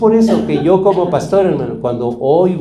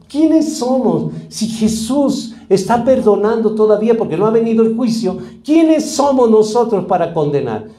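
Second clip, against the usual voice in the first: raised, insistent sermon delivery; fervent preaching; 4 semitones higher than usual. Acted. Pastor exhorts the congregation to forgive, preaching with force. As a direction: drive the call to forgive home.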